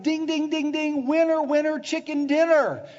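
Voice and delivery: loud, high winner announcement; jubilant game-show cheer. Acted. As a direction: ring out like a prize bell. Acts out Jesus as game-show bell.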